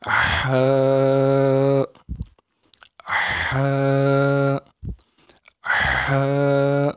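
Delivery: ہا with a clean pharyngeal 'h', vowel held; clear throat ha. Shown as a demonstration. Teacher leading pupils to repeat, throaty drawn-out ہا. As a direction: push ہا from the throat, held on one note. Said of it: "گلے سے بالکل صاف آواز نکالیں".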